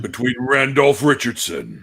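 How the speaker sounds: slow, drawn-out contender introduction; debate announcer. Pretend. Introducing debate rivals, slow fight announcer voice. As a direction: announce the name like a prizefighter. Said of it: "I think Neil should be".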